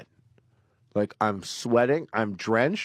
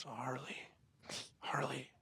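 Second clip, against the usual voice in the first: very soft, barely voiced name call; imitated hushed calling; 3 semitones higher, 18 dB quieter than usual. Frightened girlfriend waking her partner at night, hushed. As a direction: call out secretly in the dark.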